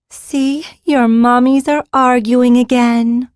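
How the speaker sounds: high, slow sing-song to babies. Acted. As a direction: lilt it to the sleeping babies. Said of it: "she said in a sing-song voice".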